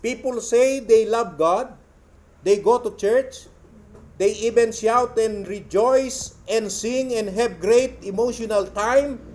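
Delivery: slow, loud declamation; emphatic quote reading. Real, not acted. Preacher reading out how people say they love God, emphatic. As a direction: deliver each phrase slowly and forcefully to a crowd. Reads quotation to congregation.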